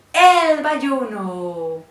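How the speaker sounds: loud, long-drawn Valluno call; booming, very slow Valluno announcement; Valluno drawl. Shown as a demonstration. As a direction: stretch it right out in a Valluno accent. Announces the dialect in put-on drawl.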